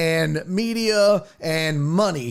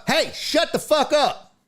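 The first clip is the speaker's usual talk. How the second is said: much higher, snapping mock retort; hammed-up brush-off to the old owner; mock angry Dion; 10 semitones higher than usual. Host playing Dion telling Jerry off, mock angry and higher. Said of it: "Dion walks in".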